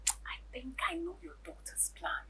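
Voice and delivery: whisper. Acted. Confiding suspicion to husband.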